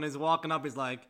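ordinary delivery

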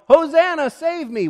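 loud, high-pitched yell; desperate acted cry for rescue; yelling for help. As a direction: scream out as if overboard. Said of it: "you would yell".

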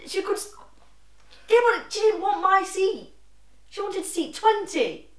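high, loud indignant complaint; exasperated. Real, not acted. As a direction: insist she wanted that seat. Defends herself, exasperated.

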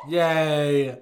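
loud happy cheer. Real, not acted. Cheering the intro.

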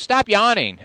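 high, sharp teacher scold; teacher telling a student off; mock stern teacher. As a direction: snap it like a strict teacher. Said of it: "So when a teacher goes".

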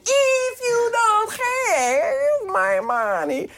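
very high, slow, wailing delivery; milk the line like a melodramatic gangster; over-the-top villain line. Retold or imitated. Imitating Malkovich on action, hammed to the hilt. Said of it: "suddenly the action".

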